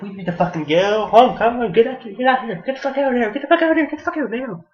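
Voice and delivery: high, loud, frantic shouts to flee; shrill terrified getaway yell; panicked yelling. Retold or imitated. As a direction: scream at your buddy to get out now, rising in panic. Driver panics at approaching rider.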